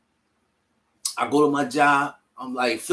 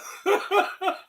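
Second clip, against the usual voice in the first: high joyful laugh. Real, not acted. Man finds hundred dollar bill, laughs high and delighted. Joy at found money.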